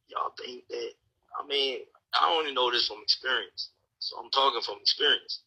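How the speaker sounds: ordinary delivery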